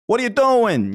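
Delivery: high-pitched mock New York-Boston accent. Podcaster comparing two cities' tinge, mock New York-Boston accent. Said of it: "they do have the same kind of".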